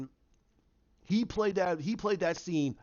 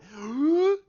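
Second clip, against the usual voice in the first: loud gasp; 9 semitones higher, 7 dB louder than usual. Real, not acted. Shocked reaction in rant.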